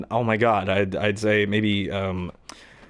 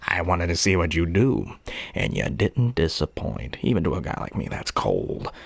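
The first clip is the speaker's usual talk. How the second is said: Joker voice; 6 semitones lower, 3 dB quieter than usual. Retold or imitated. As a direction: purr it like a cold Joker. Joker impression after discussing voices.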